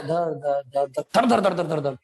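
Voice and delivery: low-pitched whirring fan noise, gathering pace; imitated speeding-up fan. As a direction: mimic a fan picking up speed. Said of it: "बढ़ा रहा होता है". (पंखे के तेज़ होने की नकल)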